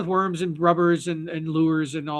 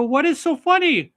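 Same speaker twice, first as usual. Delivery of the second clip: mock puzzled question; 9 semitones higher, 5 dB louder than usual. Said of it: "they go".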